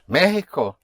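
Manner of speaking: Mexico as MÉ-hee-ko, first syllable high; Spanish Mexico, MEH-hi-ko; Spanish first-syllable stress. Shown as a demonstration. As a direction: hit the Me of Mexico, with an h for the x. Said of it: "you have an accent over the E".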